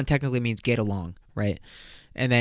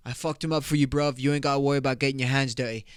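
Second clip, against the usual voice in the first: put-on British lad, rushing at the end; mock British accent; faster than usual. Guy tells brother he handled it, mock British accent. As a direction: reassure a mate in fake British. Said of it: "Bruv, they say bruv".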